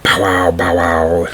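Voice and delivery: slow, voiced mock bark; dog barking. A narrator's reading. Dog joins the yell to scare robbers, barking. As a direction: woof it out like a dog.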